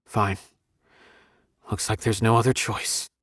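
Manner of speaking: low, subdued acceptance; resigned and downcast; reluctant resignation. Acted. Gives in reluctantly.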